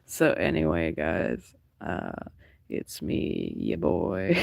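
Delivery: feverish sick voice. Retold or imitated. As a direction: play the weak streamer with a fever. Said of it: "started to get a fever".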